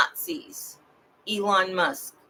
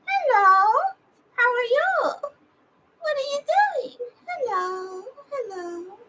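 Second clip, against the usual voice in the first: the dog's voice. Retold or imitated. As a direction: speak for the dog in a high pet voice. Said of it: "I used to have to have a voice for him".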